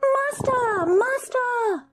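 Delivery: very high, crying little voice; a magic harp wailing for its owner; harp calling out. A narrator's reading. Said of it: "the harp called out".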